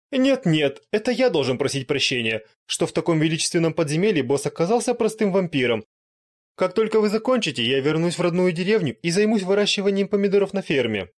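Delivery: high, loud apologetic resignation; humbled vampire. Acted. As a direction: give up and apologize.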